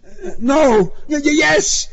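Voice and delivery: loud, very high, flustered answer; frightened high stutter; panicked stammer. A narrator's reading. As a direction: blurt it out in confusion. Cornered driver answers yelling cop.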